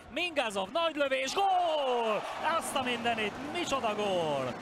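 high shouted goal call, building; excited hungarian sports commentary. Real, not acted. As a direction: yell the goal at full voice. Live hockey goal call.

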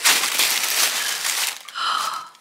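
long breathy gasp of delight. Real, not acted. Opening the box, a long surprised gasp. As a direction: gasp in surprise at what you see.

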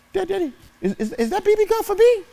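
put-on high, eager kid voice pleading; excited little boy. Young son asking his dad about the BB gun, high and eager. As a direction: squeak it out like a hopeful twelve-year-old.

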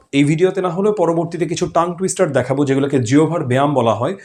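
ordinary talk

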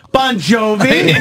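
put-on a girl recognizing the singer in a parking lot, drawn-out excited call; excited fan squeal. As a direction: call the name out slowly like a fan spotting a star. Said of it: "some girl gets out of her car and goes".

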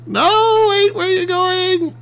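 very high, wailing plea; mock desperate calling. Player calls back his runaway machine, mock desperate. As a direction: wail after it as it escapes.

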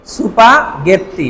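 সুপাগেত্তি stretched very slowly, mora by mora; slow katakana reading. Shown as a demonstration. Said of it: "শব্দটা কি?".

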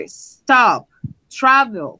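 normal talking voice

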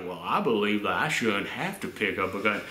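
put-on Southern accent for a film character; Andrew Garfield's Southern voice. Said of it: "that's the voice that Andrew Garfield gives his character".

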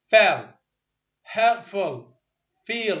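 فال هيلبفول فيل each starting on a clear f; f sound demo. Shown as a demonstration. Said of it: "وين ما ألقى ف". (نطق فال وهيلبفول وفيل بصوت الفاء)

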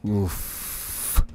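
normal talking voice

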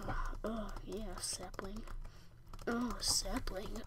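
very soft, low murmur; quiet, drawn-out savouring; hushed delight. Pretend. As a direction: say it slowly, almost whispered. Spots saplings in the game.

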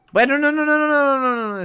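high, loud rapid nos; frantic high protest; panicked shout. Acted. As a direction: cry no to stop him. Friend about to hit send.

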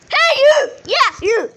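loud, high call for attention. Real, not acted. Player hailing someone in the game, calling out.

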